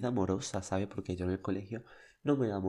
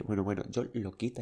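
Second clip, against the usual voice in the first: ordinary delivery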